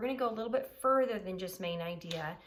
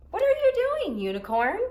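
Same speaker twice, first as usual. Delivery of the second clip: Narwhal's question; 13 semitones higher, 10 dB louder than usual. A narrator's reading. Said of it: "Narwhal asks Unicorn".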